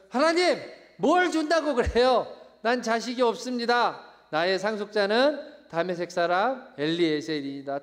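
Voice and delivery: loud, high-pitched complaint to God; exasperated outburst at God; indignant protest. Acted. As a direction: act out Abraham fed up and arguing back. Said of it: "이러는 거란 말이에요".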